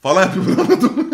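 laughing through the words; cracking up mid-sentence; giggling while telling the story. Real, not acted.

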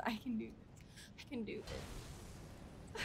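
soft, fading whisper to herself; whispered self-pep talk. Real, not acted. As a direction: breathe it out to calm down.

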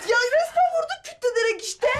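high, tearful accusation; hurt, high-pitched protest; pained complaint. Acted. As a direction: whine through the pain. Daughter hurt, accusing mother.

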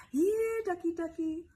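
high coaxing call; calling a duck. Acted. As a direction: coax the duck over sweetly.

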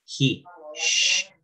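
"He" with a jota H, then a bare hushing "sh"; sounds shown separately. Shown as a demonstration. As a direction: isolate the "sh" after a clear "He". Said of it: "como si fuera una J la H".

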